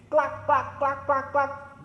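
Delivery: soft high clucking like a hen. Shown as a demonstration. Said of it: "And hen says".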